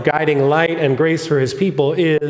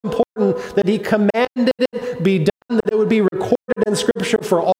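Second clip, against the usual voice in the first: high, ringing preacher's voice over music; impassioned preaching; 10 semitones higher than usual. Acted. Pastor preaching to the congregation, impassioned and high. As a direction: preach it out with full conviction.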